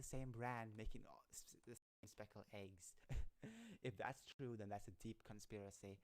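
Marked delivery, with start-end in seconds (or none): 3.0-3.8 short, high laugh. Real, not acted. Streamer cracking up mid-chat, laughing. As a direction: burst of amused laughter. Amused by own article.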